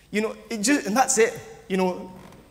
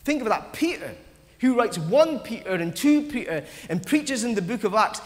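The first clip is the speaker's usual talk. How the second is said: usual speaking voice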